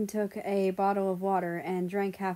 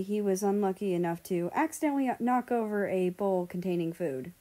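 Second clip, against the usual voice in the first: plain reading voice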